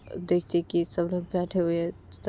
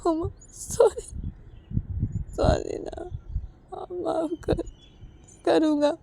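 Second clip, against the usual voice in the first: higher, sobbing apology; crying plea; 10 semitones higher, 8 dB louder, slower than usual. Acted. Sanju, sobbing, begs Uma not to be angry. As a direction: plead through tears for forgiveness. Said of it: "ऐसे रोते रोते संजू".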